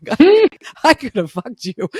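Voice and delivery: laughing through the words; breaking up mid-sentence with real laughter. Real, not acted. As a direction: mom laughing with her grown son about the past. Laughing at herself.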